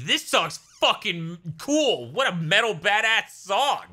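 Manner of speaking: slow, high hyped metalhead; dudebro fan voice. Retold or imitated. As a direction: gush like an impressed fan. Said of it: "would hear that song and be like".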